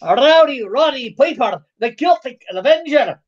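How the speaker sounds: hammed-up Scottish brogue; Scottish accent. Shown as a demonstration. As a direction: announce it in a playful Scottish accent. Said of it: "Maybe I should talk in a Scottish accent".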